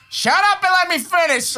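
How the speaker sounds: husband snapping at wife to hush, mock shout; mock exasperated shout. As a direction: bark it like an impatient husband. Acting himself at wife.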